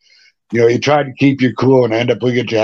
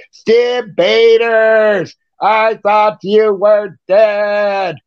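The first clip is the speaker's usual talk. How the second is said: very slow, much higher mock voice, stretched out; mock heckler; 8 semitones higher, much slower than usual. [name] heckles the singer on stage, slow high mock voice. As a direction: stretch it like a stunned fan.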